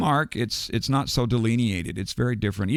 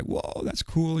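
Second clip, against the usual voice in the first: put-on impressed girls; 7 semitones higher, 4 dB quieter, slower than usual. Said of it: "they were all just like".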